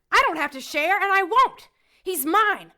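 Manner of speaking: loud, high defiant shout; possessive yell. Acted. Woman refusing to share her man, loud and defiant. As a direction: claim him at full volume.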